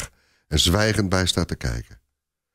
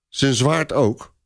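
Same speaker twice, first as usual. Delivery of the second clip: slow, higher, let-down question; disappointed; 7 semitones higher, slower than usual. Acted. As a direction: sulk over losing the sword. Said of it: "vraagt de soldaat teleurgesteld".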